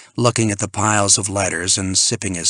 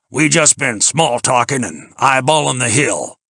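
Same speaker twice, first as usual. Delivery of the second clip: higher, loud folksy voice; loud country-style small talk; plain-spoken Ben; 4 semitones higher, 5 dB louder than usual. A narrator's reading. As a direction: explain it in a hearty, homespun voice. Ben's folksy voice in dialogue.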